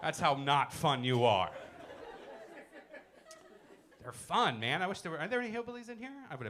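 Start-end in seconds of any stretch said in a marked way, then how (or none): none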